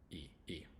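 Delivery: "E" as a separate ee vowel; E sound. Shown as a demonstration. Teacher isolating the ending of lazy, separate ee sound. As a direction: sound "E" alone, twice. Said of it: "the Y has an E sound".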